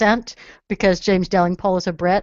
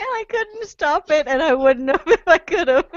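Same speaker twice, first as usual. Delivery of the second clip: laughing; 8 semitones higher than usual. Real, not acted. Laughing while talking.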